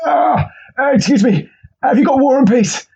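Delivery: high, groaning library request; strained meathead. Retold or imitated. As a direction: grunt the question like mid-lift. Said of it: "they just go to the library like".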